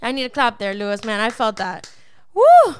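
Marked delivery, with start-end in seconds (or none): 2.3-2.7 high, loud celebratory woo; a real burst of excitement after the sermon point; excited whoop. Real, not acted. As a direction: let out a joyful shout of praise. Preacher excited by own revelation.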